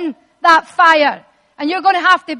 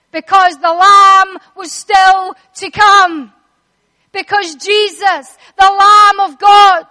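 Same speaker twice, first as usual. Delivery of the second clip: loud, higher proclaiming; full-force preaching; 4 semitones higher, 6 dB louder than usual. Acted. Preacher declaring Jesus as the coming Lamb, loud climax.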